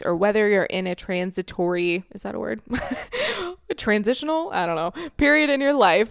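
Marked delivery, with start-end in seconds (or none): 2.6-3.6 light embarrassed giggle; short high laugh. Real, not acted. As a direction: laugh at her own word mix-up. Unsure of the word.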